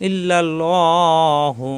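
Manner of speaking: ইল্লাল্লাহু with a full, heavy ল and slow drawn end. Shown as a demonstration. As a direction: round the lam in ইল্লাল্লাহু. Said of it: "এই আল্লাহ শব্দের ডানে জবর আসায় ল হবে". (ইল্লাল্লাহু ভারী লামে টেনে)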